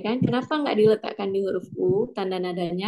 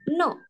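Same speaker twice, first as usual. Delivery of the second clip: no falling sharply from high; fourth tone; 8 semitones higher than usual. Shown as a demonstration. Tone mnemonic for students, fourth tone. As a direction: drop no briskly from a high start. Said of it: "dan nada empat".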